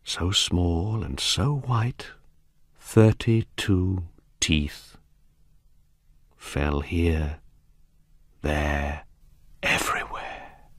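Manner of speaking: slow, soft storyteller's ending; hushed horror. A narrator's reading. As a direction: let it die away slowly into a near whisper.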